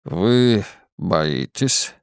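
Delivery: slow, low muffled voice; eldritch horror. Acted. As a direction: speak from far in darkness. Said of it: "Он звучал приглушённо".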